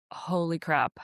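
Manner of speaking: ordinary talk, nothing marked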